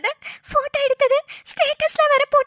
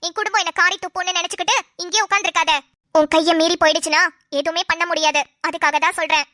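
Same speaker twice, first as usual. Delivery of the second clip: lower, urgent friend pressing her advice; a lower-voiced scheming friend urging the crying wife; scheming friend Roja; 7 semitones lower than usual. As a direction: push her to go home now, low and insistent.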